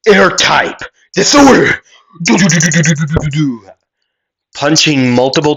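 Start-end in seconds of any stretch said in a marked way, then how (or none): 0.1-1.8 high, loud battle cry; yelled attack call. Acted. Midoriya launching an air attack, yelling out. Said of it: "As he would yell out". 2.2-3.7 high rattling vocal sound effect; rapid-fire punch noises. Acted. Storyteller imitating multiple shockwave punches, rattled off high.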